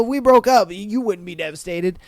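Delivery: ordinary delivery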